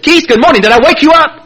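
high-pitched, mock-cheerful phone hello; over-bright greeting. Man calling his sponsor before five, over-eager greeting. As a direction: imitate an overeager early caller. Acting early call to sponsor.

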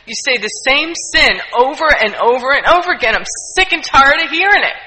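retold priest rising to a loud angry scolding; a priest in tears of anger at confession, rising to a loud scolding. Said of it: "tears of anger and frustration".

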